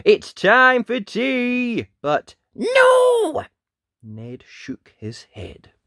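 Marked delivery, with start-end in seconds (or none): 0.1-1.9 high, drawn-out loud call. A narrator's reading. Parents calling Ned in for tea, loud drawn-out call. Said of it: "They called him in". 2.5-3.4 high, loud long no; stubborn refusal. A narrator's reading. Ned refusing to come in, loud high shout.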